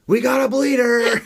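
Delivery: high, loud movie-line yell; mock bleeder shout. Podcast host quoting the fireman who yells at the crew, hammed-up high shout. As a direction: bellow it like the fireman on the scene.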